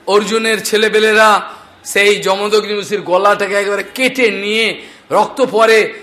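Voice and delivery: ordinary talk, nothing marked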